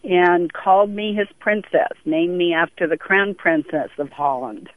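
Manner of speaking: ordinary delivery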